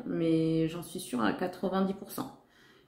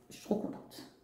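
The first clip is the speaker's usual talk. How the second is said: whisper; 14 dB quieter than usual. Real, not acted. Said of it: "vous avez compris que j'étais contente".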